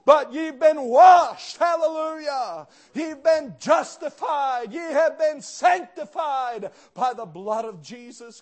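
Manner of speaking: slow, high, loud proclamation; preaching shout. Acted. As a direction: thunder the verse over the crowd. Preaching scripture at full force.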